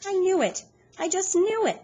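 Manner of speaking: high, loud, gleeful triumph; high delighted woman voice. A narrator's reading. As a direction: crow it like she just won. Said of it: "we can hear her laughing and saying".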